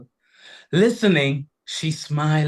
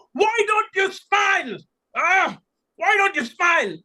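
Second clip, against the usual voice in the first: desperate shout; 10 semitones higher than usual. A narrator's reading. Lord shouting at wife.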